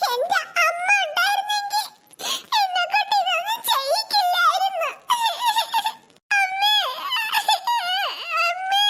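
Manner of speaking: crying for mother. Acted. Orphan girl overworked by her aunt, sobbing for her mother. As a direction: cry out for the mother she lost. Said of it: "അയ്യോ, കരയുന്നു".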